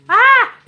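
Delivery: high loud squeal of delight; overwhelmed squeal. Real, not acted. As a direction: let out a loud happy shriek. Overwhelmed by donations.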